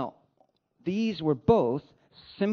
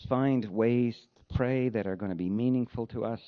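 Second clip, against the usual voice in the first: lower, unhurried prayer voice; closing prayer; 6 semitones lower than usual. Acted. Pastor leading the congregation in closing prayer, reverent and lower.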